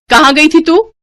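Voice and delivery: loud, high scolding shout; angry shout. Acted. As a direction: snap at her from across the house. Angry sister-in-law scolding. (गुस्से से चिल्लाकर)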